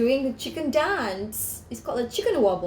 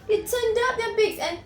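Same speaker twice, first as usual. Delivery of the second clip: lightly voiced chickens; 9 semitones higher than usual. A narrator's reading. Narrating chickens in high voice.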